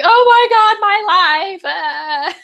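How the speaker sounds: high, loud, exasperated cry; friend's dramatic wail. Retold or imitated. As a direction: overdramatic best friend moaning about her life. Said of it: "and she's like".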